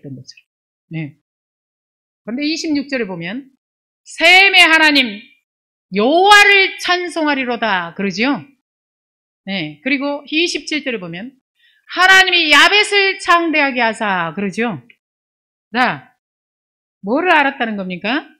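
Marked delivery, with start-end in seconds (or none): 4.0-7.9 high, loud, slow-opening declamation; stretched, forceful verse recitation; scripture proclamation. Acted. Quotes scripture. 11.9-14.3 high, loud verse recitation; ringing Bible-reading voice; scripture proclamation. Acted. Quotes scripture.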